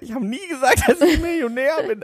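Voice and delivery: high voice, laughing through the words; answering the drunk guy, high and laughing; laughing denial. Retold or imitated. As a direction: deny it while cracking up. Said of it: "Hab ich gesagt".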